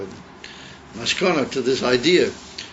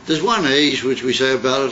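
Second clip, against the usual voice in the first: ordinary delivery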